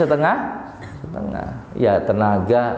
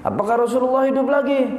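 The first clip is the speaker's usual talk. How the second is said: much higher, astonished crowd crying out; excited shout; 12 semitones higher, faster than usual. Acted. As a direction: call out in disbelief and joy. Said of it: "Orang-orang teriak".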